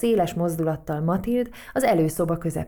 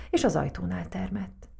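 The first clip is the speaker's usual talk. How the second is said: ordinary delivery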